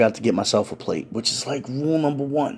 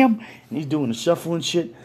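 ordinary delivery, nothing marked

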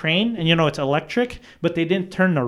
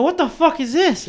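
high-pitched, incredulous question; re-enacted bewildered alarm; alarmed disbelief; 12 semitones higher than usual. Retold reaction to gated basement.

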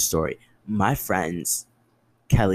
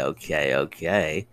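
ordinary talk

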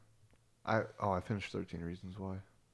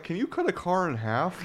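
loud, much higher ad voice; hyped pitchman; 10 semitones higher, 8 dB louder than usual. Retold or imitated. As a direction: shout it like a TV salesman. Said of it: "He's like".

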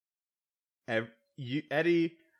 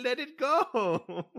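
slow, high pleading let it go; pleading friend; 10 semitones higher, slower than usual. Acted. To a friend who keeps pressing, high pleading plea. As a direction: beg her to drop it, drawn out.

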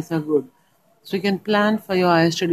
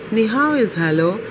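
usual speaking voice

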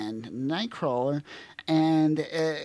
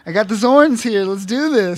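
loud, high hype voice; mock rallying cry; 9 semitones higher, 12 dB louder than usual. A host voicing David arriving, loud and high. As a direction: pump up the team loudly.

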